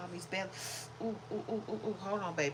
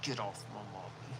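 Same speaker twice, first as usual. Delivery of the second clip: hushed, menacing dog's warning; glaring dog; 10 semitones lower, 11 dB quieter than usual. Pretend. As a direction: voice the dog's warning under your breath. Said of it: "she's locking eyes with him like".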